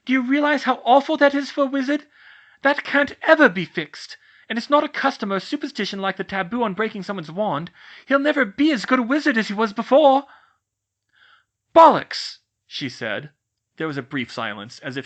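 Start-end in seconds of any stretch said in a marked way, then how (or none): 0.1-10.3 high, loud anguished yelling; yelling back in despair. Acted. Cho still yelling in argument. 11.7-12.4 very loud, high exclamation; furious outburst. Acted. Outburst surprising even herself.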